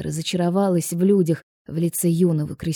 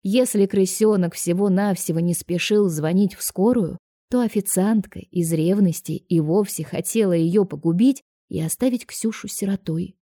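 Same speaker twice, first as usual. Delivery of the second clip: plain reading voice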